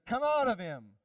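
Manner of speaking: commanding shout of authority; stern command. Acted. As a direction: command it forcefully, pitch up. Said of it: "Jesus said sternly".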